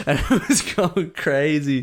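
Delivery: laughing through the words; slow, amused, laughing. Real, not acted. Laughing while retelling mosh.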